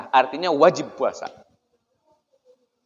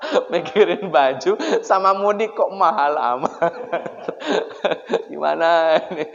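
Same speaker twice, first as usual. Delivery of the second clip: laughing through the joke; amused laughing. Real, not acted. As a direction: say it while chuckling at the absurdity. Joking about worldly worries, laughing. (sambil tertawa geli)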